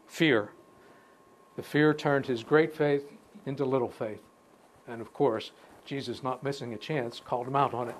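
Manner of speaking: ordinary talk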